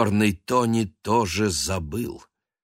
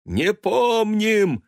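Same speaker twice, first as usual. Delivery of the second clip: high, loud, slow yell; 6 dB louder, much slower than usual. Acted. Cats yelling back at once, high loud yell. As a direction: roar it as a crowd. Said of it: "орали коты".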